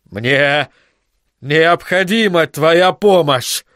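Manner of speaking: slow, strained demand through the teeth; forced out grudgingly. Acted. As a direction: squeeze each word out slowly. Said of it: "процедил он". (медленно цедить сквозь зубы)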